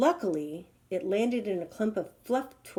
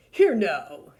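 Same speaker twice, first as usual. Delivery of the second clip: louder indignant snort; 8 dB louder than usual. Acted. Tangled giraffe snapping at the vine, louder. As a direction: bark it at the vine. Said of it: "snorted the giraffe".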